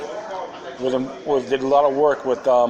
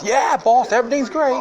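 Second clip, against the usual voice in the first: high-pitched, louder mock servant voice; eager yes-boss act, pitched way up; servile peasant; 11 semitones higher, 4 dB louder than usual. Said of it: "be house and field peasants".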